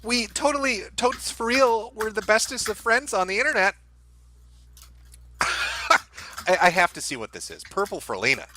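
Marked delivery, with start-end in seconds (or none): 0.0-3.8 high, sing-song parody of a gushy post; mock teenage gush. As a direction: sneer it like a sugary online bestie. Mocking a gushing online friend.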